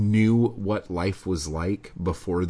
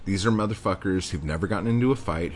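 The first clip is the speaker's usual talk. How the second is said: ordinary talk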